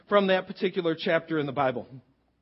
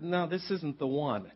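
inner voice; 3 semitones lower, 8 dB quieter, slower than usual. Retold or imitated. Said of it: "inside I have this nagging feeling".

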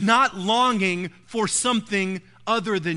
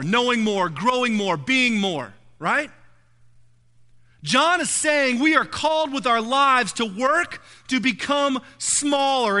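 louder driving sermon voice, building; full preaching; 3 semitones higher, 6 dB louder than usual. Acted. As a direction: preach it hard to the congregation. Preaching crescendo on increase.

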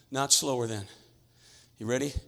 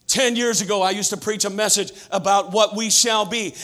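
preaching; 2 semitones lower, 5 dB quieter than usual. Acted. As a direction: preach it steadily to the church. Measured preaching.

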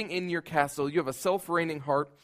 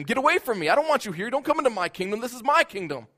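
louder, higher, defiant and bitter; bitter lashing out; 5 semitones higher, 5 dB louder than usual. Acted. Preacher voicing a broken, lonely heart pushing people away, bitter shout. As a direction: snap them away from you. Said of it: "you gnash your teeth at them".

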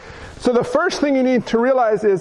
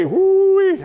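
ooh-wee; 8 semitones higher, 3 dB louder than usual. Real, not acted. Reaction to the complaint.

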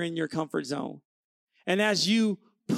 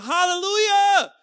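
high, loud, drawn-out hallelujah; stretched-out celebratory shout; screamed hallelujah; 11 semitones higher, 7 dB louder than usual. Pretend. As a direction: holler it like a jubilant farmer. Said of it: "farmers all over the world screaming".

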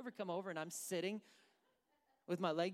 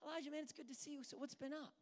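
higher, softer chummy hello; casual greeting; 6 semitones higher, 6 dB quieter, faster than usual. Acted. God casually greeting Elijah as imagined, chummy and light. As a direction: greet an old pal lightly. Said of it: "I don't think that was just like".

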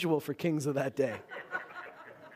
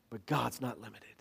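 hushed conviction; 8 semitones lower, 8 dB quieter than usual. Real, not acted. Sermon point after loud build.

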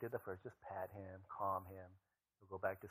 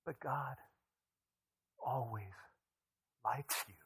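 ordinary talk, nothing marked

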